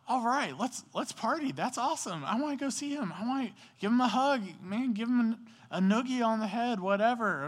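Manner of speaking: high-pitched, eager party cheer; bubbling over, wants to hug him now; excited. Pretend. Older brother rushing to greet his returned brother, high excited. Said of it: "he was not excited like that".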